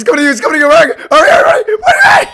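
high, loud panicked wail. Real, not acted. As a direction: beg them to hurry in panic. Panicked urging while being chased.

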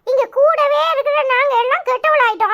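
ordinary talk

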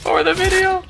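high, loud hype yell; excited shout. Real, not acted. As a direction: yell it like a battle cry. Hyping teammate for video.

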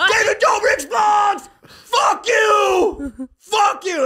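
high, slow, full-volume mock rant; enraged drawn-out shouting; screaming stranger. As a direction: bellow each insult like an imitated maniac. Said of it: "The guy just goes".

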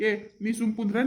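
ordinary talk, nothing marked

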